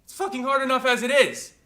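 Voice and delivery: angry outburst. Acted. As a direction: escalate into an outraged cry. Same complaint repeated, angrier.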